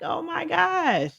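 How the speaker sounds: high, slow, drawn-out gasp of feeling; touched and amazed, drawn out high; overwhelmed oh my gosh. Retold or imitated. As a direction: let it stretch out, moved and amazed. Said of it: "I'm like".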